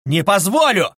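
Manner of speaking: mild shout. Acted. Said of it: "крикнул было другой раз".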